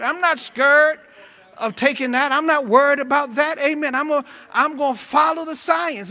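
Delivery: fervent sermon push; preaching. Real, not acted. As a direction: urge the church not to fear. Preaching about the vaccine.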